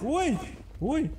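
mock panic. Playful panic in game.

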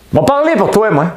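very high, loud squawk; mock outburst. Comedian telling off the politician, very high mock yell. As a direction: burst out in an exaggerated high yell.

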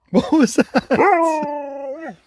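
high, slow laughing what was that; laughing surprise. Real, not acted. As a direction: laugh out your surprise at the dog. Dog makes odd noise.

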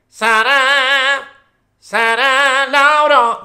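[name] impression. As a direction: ham up [name]'s voice, dragging the words. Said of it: "c'ha questa voce qua, nasale". (imitazione di [name], più acuta e forte, strascicata)

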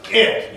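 crisp glottal attack on "It."; closed glottis onset. Shown as a demonstration. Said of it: "You started with a closed glottis".

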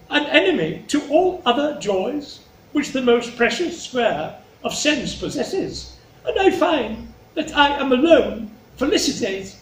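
high, measured, theatrical courtly speech; Regan, gushing flattery. Acted. Regan outdoing her sister before the king, high gushing. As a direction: lay on the devotion in a high, stately voice.

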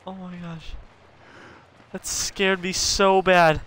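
usual speaking voice

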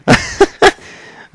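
squeaky high burst of laughter. Real, not acted. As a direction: let out a high cackle. Laughs at game scene.

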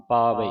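ਪਾਵੇ low and firm, long first vowel; careful pronunciation demo. Shown as a demonstration. Teacher spells it out, then models ਪਾਵੇ low and emphatic. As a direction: model ਪਾਵੇ loudly with its final vowel sounded. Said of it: "ਇਸ ਤਰ੍ਹਾਂ ਉਚਾਰਨ ਕਰਨਾ ਹੈ".

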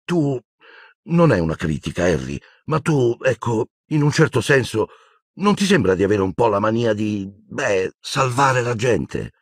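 high, halting and hesitant; nervous resolve. A narrator's reading. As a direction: pick each phrase cautiously, dreading the reaction. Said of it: "con aria spaventata ma decisa". (esitante, timorosa ma decisa)

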